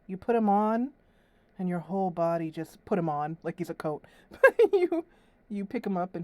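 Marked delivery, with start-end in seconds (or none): none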